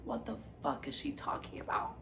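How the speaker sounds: whispered disbelief, no voice at all; hushed, baffled retold reaction; bewildered whisper. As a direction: whisper it as a stunned aside. Retelling her bewildered private reaction.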